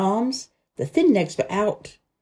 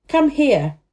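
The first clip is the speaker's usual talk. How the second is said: raised, urgent command; shout; 6 semitones higher, 5 dB louder than usual. A narrator's reading. Boy shouting to his helper, urgent command. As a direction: shout for help to come. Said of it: "he shouted to Dickon".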